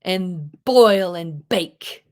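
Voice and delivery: "And boil and bake" slowed, with punchy b and k; slow hard consonants. Shown as a demonstration. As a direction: bite down on the b and k of "boil" and "bake", slowly. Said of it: "The k sound".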